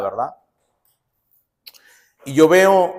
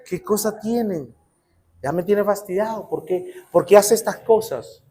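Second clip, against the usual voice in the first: puzzled muttering; 6 dB quieter than usual. Retold or imitated. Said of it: "La otra vecina va a decir".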